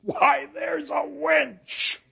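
high, slow, delighted cry; delighted Petruchio. Acted. Petruchio praises his wife, high and delighted. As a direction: relish the prize. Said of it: "Petruchio's reply".

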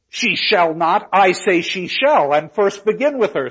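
ringing stage command; insistent Petruchio. Acted. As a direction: overrule her, punching each word. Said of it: "says Petruchio again".

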